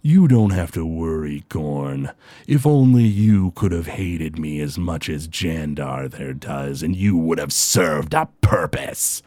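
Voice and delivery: low, slow, gloating villain voice; villain gloating low at a dazed brute; sinister villain. Acted. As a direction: savour every word, low and slow. GM voices Groon.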